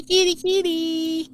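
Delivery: very high, drawn-out pet call; calling a cat. Real, not acted. Spotting a little kitty mid-show, in a high singsong call.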